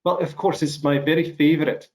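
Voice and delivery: put-on Scottish accent, on demand; playful Scots lilt. Shown as a demonstration. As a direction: show off your Scottish accent. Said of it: "hear your Scottish accent right now".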